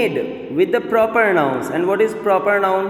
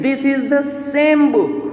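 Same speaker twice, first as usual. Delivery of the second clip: teacher stressing "same", pitched high; forceful emphasis on same; 8 semitones higher than usual. Shown as a demonstration. Teacher showing students an emphasizing adjective, "same" stressed forcefully. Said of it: "we are telling here forcefully".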